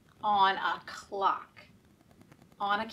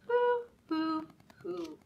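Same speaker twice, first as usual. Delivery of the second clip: owl hoot; 7 semitones higher than usual. Acted. Owl sound in picture book.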